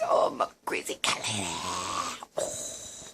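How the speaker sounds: quick breathy whisper, no voice. Acted. Owner whispering about herself to the cats, whispered. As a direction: hush it, quick and secret. Same phrase, whispered take.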